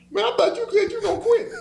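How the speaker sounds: very high, laughing teasing voice; laughing tease. Retold or imitated. Teammate ribbing him for coming back, very high and laughing.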